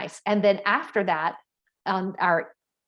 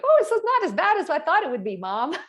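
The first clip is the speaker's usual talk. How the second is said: much higher, fast, teasing daughter voice; imitating daughter; 9 semitones higher, 4 dB louder than usual. Daughter judging mom's painting, much higher mock voice. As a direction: play the grudgingly impressed daughter. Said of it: "my daughter's like".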